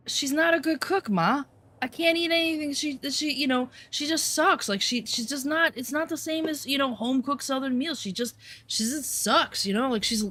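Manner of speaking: high, whiny mockery of the boyfriend; mocking whiny ex. Mocking the boyfriend whining to his mom in a high voice. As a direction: sneer his complaints back in a high voice.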